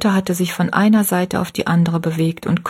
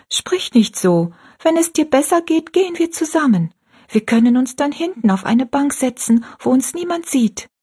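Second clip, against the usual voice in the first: put-on higher, pleading little girl's voice; 7 semitones higher than usual. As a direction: coax her mother with hope. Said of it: "widersprach ihr Rosalie".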